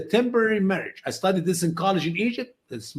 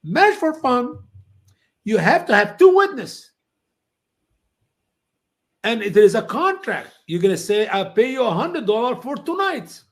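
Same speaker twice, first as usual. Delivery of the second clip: voicing the lady; 5 semitones higher than usual. Lady explaining mut'a rules, higher voice. Retold talk with Iranian lady.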